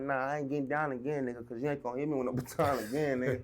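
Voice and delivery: telling the officer he won't fight again; laughing retort. Retold or imitated. Mocking, laughing comeback.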